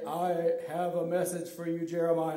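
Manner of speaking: low, measured God voice; deep booming voice. Shown as a demonstration. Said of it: "Let's hear your deep, booming voice".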